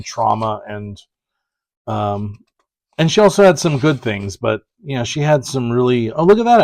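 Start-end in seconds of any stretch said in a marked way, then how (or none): 6.1-6.6 surprised. Real, not acted. Spots unexpected covers in book.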